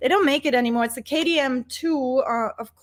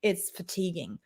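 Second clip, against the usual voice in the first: normal talking voice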